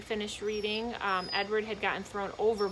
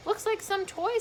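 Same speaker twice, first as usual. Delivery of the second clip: put-on grizzled old man; 12 semitones higher, 3 dB louder than usual. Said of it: "said the grizzled old man".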